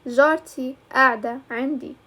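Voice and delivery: جارتي قاعدة عندي with a glottal stop opening قاعدة; another dialect's pronunciation. Shown as a demonstration. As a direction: say قاعدة with no q, as "ada". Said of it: "you might read it like".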